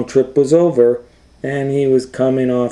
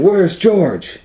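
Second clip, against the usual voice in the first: sailors calling; 11 semitones higher, 3 dB louder than usual. Acted. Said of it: "they were saying".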